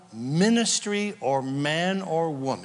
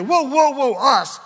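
startled interjection; 9 semitones higher, 7 dB louder than usual. Real, not acted. Preacher stops on the word.

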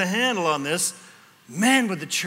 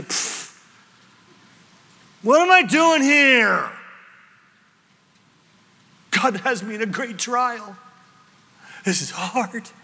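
a scoff, then a higher, louder whine; grumbling dissatisfaction; 4 semitones higher, 3 dB louder than usual. Pretend. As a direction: sigh it out like a fed-up worker. Office worker grumbling to himself.

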